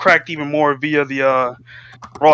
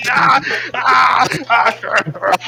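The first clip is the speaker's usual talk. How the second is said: hammed-up wailing yells, easing off; anguished wail; 8 semitones higher, much slower than usual. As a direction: cry out in acted despair. Acted wailing in desperate plea.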